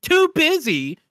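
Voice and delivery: text-to-speech voice. Acted. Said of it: "Two text-to-speech voices read out".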